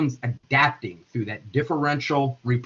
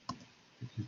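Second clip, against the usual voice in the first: ordinary delivery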